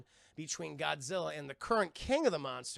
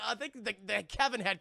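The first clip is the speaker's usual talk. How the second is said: ordinary delivery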